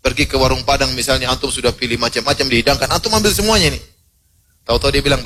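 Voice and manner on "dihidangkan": usual speaking voice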